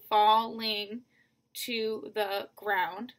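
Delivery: slow, separated syllables in "falling to the ground"; "falling to the ground" drawn out slowly, beat by beat. Shown as a demonstration. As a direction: count out "falling to the ground" with a gap after each syllable. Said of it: "five syllables".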